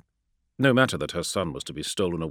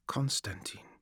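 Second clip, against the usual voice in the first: unvoiced, slow whisper; slow whispered repeat; 10 dB quieter than usual. A narrator's reading. Minister repeating the killer's name, slow whisper. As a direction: turn the name over softly. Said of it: "she repeated slowly".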